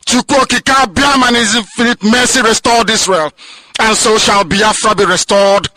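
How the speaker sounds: high, loud fiery sermon cadence; preaching at full force. Acted. Leader rallying listeners on radio, preaching at full force. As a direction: thunder it out like a pulpit preacher.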